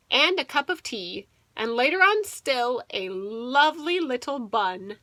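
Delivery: ordinary delivery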